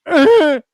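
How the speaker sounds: high loud strangled grunt of a hit mascot; mock pained grunt. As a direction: squeeze out a winded grunt. Tigger punched, grunt of pain.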